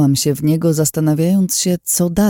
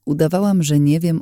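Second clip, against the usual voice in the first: usual speaking voice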